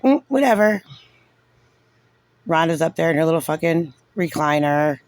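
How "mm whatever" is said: lightly mimicked dismissal, pitched up; mock dismissive Jocelyn. Imitating Jocelyn's reaction in the meeting, mock dismissive. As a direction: brush it off in a mock higher voice. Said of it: "She's like".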